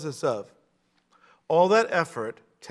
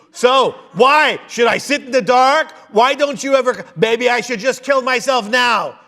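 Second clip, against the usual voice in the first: loud, high, martyred whine; guilt-trip them like a long-suffering mother; complaining mother sighing over her children, whining loudly; 6 semitones higher, 6 dB louder than usual. Retold or imitated. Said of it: "This is the traditional whining".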